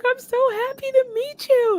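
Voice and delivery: high-pitched, drawn-out gush; gushing excitement. Retold or imitated. Star greeting a fan after a hug, gushing.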